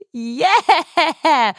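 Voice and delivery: high, drawn-out whoop; excited, high-pitched hooray; celebratory cheer. Real, not acted. Cheering the secret palindrome holiday.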